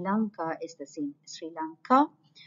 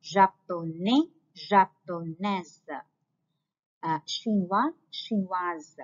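slow demo pair; slower than usual. Shown as a demonstration. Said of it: "Belge is the same for both".

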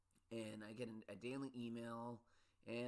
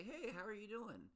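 higher, bright imitation of a caller asking; hammed-up friendly phone hello, pitched up; mock chirpy phone greeting; 11 semitones higher than usual. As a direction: mimic a breezy caller checking in. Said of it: "if you call me up and say".

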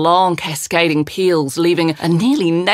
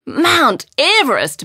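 high, drawn-out amazed exclamation; astonished cry, pitched well up; exclaiming; 9 semitones higher, slower than usual. A narrator's reading. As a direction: exclaim it in wonder, stretching both words. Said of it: "Mary exclaimed".

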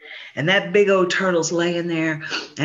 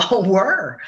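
ordinary delivery

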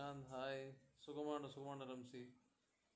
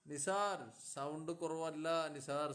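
raised, stretched-out delivery; loud calling out; 2 semitones higher, 7 dB louder, slower than usual. Real, not acted. Streamer telling Nisar the sound is fine, calling loudly. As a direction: call slowly and loudly to the listener.

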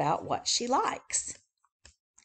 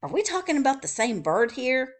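incredulous; 5 semitones higher, 8 dB louder, faster than usual. Acted. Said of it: "Raccoon says".

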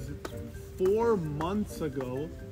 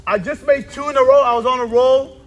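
indignant; 6 semitones higher, 7 dB louder than usual. Real, not acted. Complaining friends iced him.

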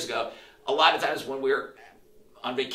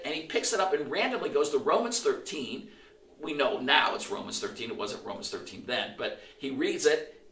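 ordinary delivery

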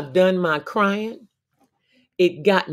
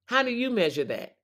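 ordinary talk, nothing marked

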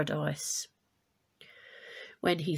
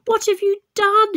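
shrieking, much higher and louder; screaming; 13 semitones higher, 10 dB louder than usual. Acted. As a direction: scream it at Pandora in horror. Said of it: "he screamed at Pandora".

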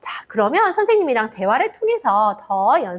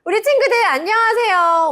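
bright greeting; 9 semitones higher, 4 dB louder than usual. Acted. Greeting children in lesson.

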